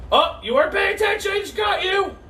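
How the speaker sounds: high, loud, fast gotcha; playful tease. Pretend. Teacher teasing kids who missed the block, high and loud. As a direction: gloat playfully that you caught them.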